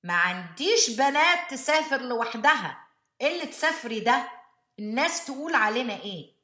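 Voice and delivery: fast, loud, high scolding in Arabic; imitating mom. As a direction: rattle it off like an outraged mother. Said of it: "My mom".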